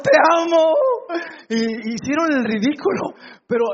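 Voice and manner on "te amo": high, loud lovestruck call; shouted serenade. Retold or imitated. Suitor shouting up to the balcony, imitated lovestruck yell. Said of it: "le gritaron".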